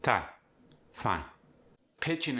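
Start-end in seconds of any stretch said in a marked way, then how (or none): 0.0-1.2 "time" and "fine" with a clipped single vowel, like "tahm", "fahn"; shortened I. Shown as a demonstration. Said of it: "gonna be shortened".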